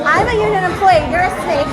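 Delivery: loud, high, angry quote; mock angry yell. Retelling his parting insult to him, mock furious yell. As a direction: yell it as he stormed off. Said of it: "And then you said".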